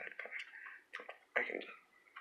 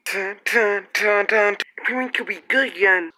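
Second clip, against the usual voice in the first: high dramatic fanfare voice; 11 semitones higher, 9 dB louder than usual. Pretend. Trailer fanfare voice.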